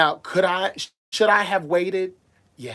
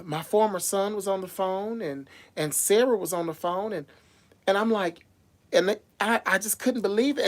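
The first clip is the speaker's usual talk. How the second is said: ordinary talk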